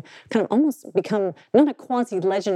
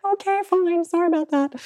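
high, meek student apology; 9 semitones higher than usual. Retold or imitated. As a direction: squeak out a sheepish apology. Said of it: "students be like".

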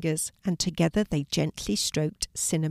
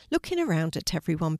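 ordinary delivery, nothing marked

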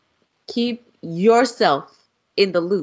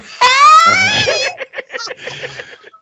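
loud cackling laugh; 9 dB louder than usual. Real, not acted. Laughing hard at the joke.

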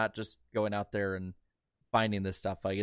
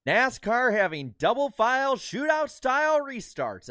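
high, slow, nasal broadcaster voice; nasally announcer impression; 14 semitones higher, 5 dB louder, slower than usual. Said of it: "Bill Weber with his nasally ass voice".

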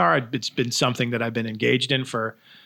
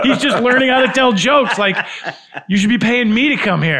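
high, loud incredulous protest; mock indignation; 8 semitones higher, 5 dB louder than usual. Comic voicing customers balking at paying for a beginner, mock-outraged. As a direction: complain like an affronted customer, pitched up. Acting out customers refusing to pay.

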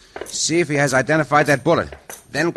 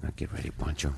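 much softer hushed readiness; whispering; 10 semitones lower, 13 dB quieter, faster than usual. Acted. Cisco and Pancho readying to jump the guard, whispering. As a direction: breathe it so the guard won't hear.

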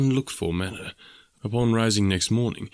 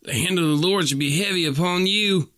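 put-on much higher, a little louder young man's cry; 9 semitones higher, 3 dB louder than usual. A narrator's reading. Young suitor threatening the farmer with divine punishment, much higher crying out. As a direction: call down the Lord's wrath on him. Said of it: "cried young Drebber".

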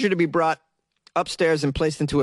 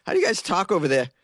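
ordinary talk

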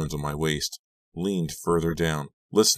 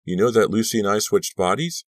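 higher, louder, rising to the end; astonished, pitched-up question; exclaimed question; 6 semitones higher, 5 dB louder than usual. A narrator's reading. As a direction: ask it in amazement. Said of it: "I exclaimed".

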